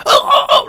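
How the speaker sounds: high-pitched mock wail; imitated high cries. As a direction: imitate her crying out in short high bursts. Imitates grandmother during deliverance.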